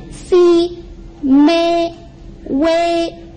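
si, me, wei each held flat and high; sing-level first tone on si, me, wei. Shown as a demonstration. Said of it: "It's flat and it's a little bit high".

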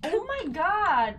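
loud, slow Oh my God; shocked exclamation. Real, not acted. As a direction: gasp it out in shock. Sudden shock.

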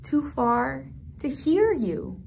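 slow story line fading to a hush on Tatita; storybook reading, grief gently; tender sad reading. Acted. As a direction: let the sadness settle, trail off.

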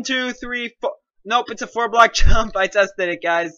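ordinary delivery

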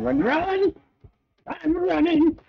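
high panicked squeal while fleeing; being chased. Real, not acted. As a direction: squeal it, running for your life. Chased by enemies in game.

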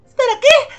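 high, loud rising question; astonished. Acted. Reacting to a sudden invitation, astonished. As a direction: squeak it up in disbelief.

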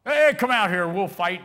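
loud, high call to battle; challenging giant. Acted. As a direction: bellow the challenge, then ease off. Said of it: "Remember what the giant said to him?".

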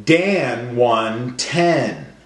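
slow and deliberate, each word drawn out; slow, stretched sentence. Shown as a demonstration. Teacher reading slowly to children for sound spotting. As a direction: stretch every word so the ending sound carries. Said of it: "every word's gonna end with the same sound".

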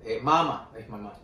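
ordinary talk

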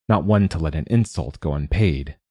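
ordinary delivery, nothing marked

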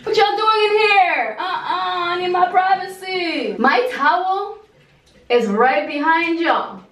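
high, shrieking, laughing protest; startled outrage. Real, not acted. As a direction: shriek at the intruders, half laughing. Caught off guard in the shower.

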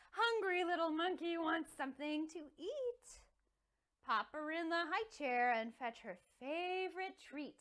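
lively storybook voice with playful sound words; reading to children. Acted. Teacher reading Cheeky Monkey to toddlers, animated. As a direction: read it like a picture book for little ones. Picture book read to toddlers.

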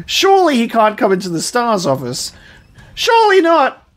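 loud, high protest of disbelief; high-pitched panicked shout; alarmed disbelief. Real, not acted. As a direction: yell it in real alarm, rising to a shriek. Enemy may follow into safe room.

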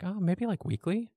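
high, tentative mock answer; unsure guess. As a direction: float it up like an honest guess. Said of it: "And I was like".

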